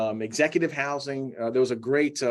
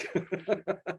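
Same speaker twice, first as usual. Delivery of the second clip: short breathy laugh; embarrassed laugh; 7 semitones higher, 5 dB quieter than usual. Real, not acted. Laughing off swallowing a bug.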